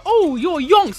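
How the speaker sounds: mock British speaker. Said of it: "they come back and go".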